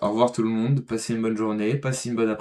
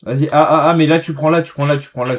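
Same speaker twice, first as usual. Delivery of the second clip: higher, louder urgent directions; urgent excited; 4 semitones higher, 9 dB louder than usual. Real, not acted. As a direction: push your teammate to go. Urgent instruction mid fight. (pressant et excité)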